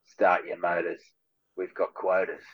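gruff boss barking orders, understated; villain catchphrase. Retold or imitated. Actor quotes his own villain line with a hint of a gruff boss. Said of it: "I've got another one where I go".

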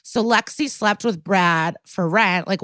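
ordinary delivery

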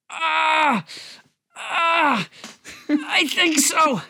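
winded, strained voice under weight; pained groan. Acted. Pinned under a fallen couch.

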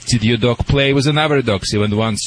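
usual speaking voice

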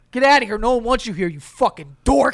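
loud mock-aggressive dismissal; party bully. Bully at a house party turning a guest away, loud and scornful. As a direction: throw him out with a hammed-up holler.

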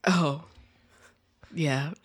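ordinary talk, nothing marked

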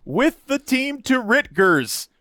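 Ritgers said slowly with a short i sound, high; misread Rutgers. Shown as a demonstration. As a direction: Ritgers drawn out, not Rutgers. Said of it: "I am reading it as it is spelled".

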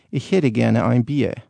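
Northern German accent, slow and drawn out. Shown as a demonstration. Demo for a host, in a Northern German accent. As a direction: order a beer like a Northern German. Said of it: "A Northern German would say".

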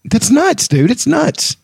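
high, excited exclamation. Real, not acted. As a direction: squeal it out in disbelief. Excited exclaim about actor.

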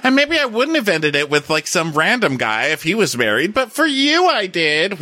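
high-pitched imitation of an apology; hammed-up self-justifying apology; Rinna's apology, acted. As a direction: plead it in a high, put-on voice. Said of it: "But Rinna's like".

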